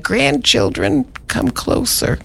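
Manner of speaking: slow, breathy granny call; old grandmother voice. Pretend. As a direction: beckon the grandkids like an old woman. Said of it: "a thing that she would say".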